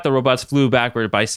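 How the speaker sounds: usual speaking voice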